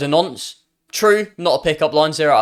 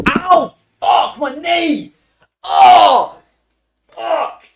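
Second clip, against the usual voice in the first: sudden high cries of pain; real pain yell; 13 semitones higher, 7 dB louder, much slower than usual. Real, not acted. Streamer just hurt their knee mid-sentence, yelling in pain. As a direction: yelp and swear loudly in real pain.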